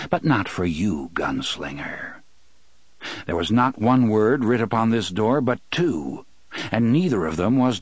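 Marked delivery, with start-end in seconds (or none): 0.1-2.2 low menacing voice. A narrator's reading. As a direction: let gunslinger sink into a low threat. Walter quoted, low menace.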